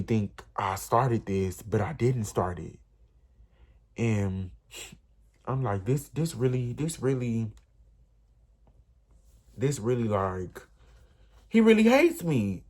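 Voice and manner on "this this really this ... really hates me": a half-sobbing, breaking plea; retold crying. Crying alone on the staircase, voice breaking upward. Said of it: "I just started crying".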